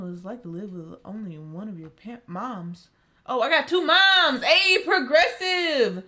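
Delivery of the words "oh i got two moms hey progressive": loud, delighted exclamation, last lines slow; excited. Real, not acted. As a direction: shout it out like a happy discovery. Excited realisation about two moms.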